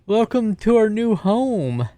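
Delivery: high, drawn-out, theatrical welcome; showy hostess flourish; grand welcome. Acted. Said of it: "make a grand gesture of".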